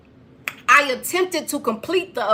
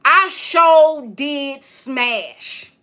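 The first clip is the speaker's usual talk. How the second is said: slow drawn-out catchphrase; much slower than usual. Acted.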